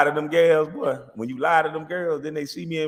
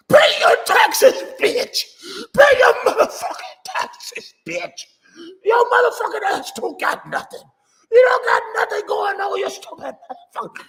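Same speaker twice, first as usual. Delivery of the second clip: shrill, much higher mock yelling with a fake cry; mocking Gina's yelling; 7 dB louder than usual. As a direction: ham up a woman screaming and crying about taxes. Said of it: "that been up there yelling and shouting".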